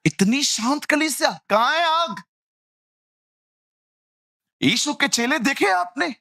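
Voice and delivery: high, fervent challenge; high fervent call for fire; fiery preaching. Real, not acted. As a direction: stir them up. Preacher rouses congregation.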